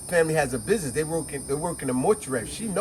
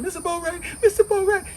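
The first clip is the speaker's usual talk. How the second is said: frantic calling; 4 dB louder than usual. Retold or imitated. Annette shaking him.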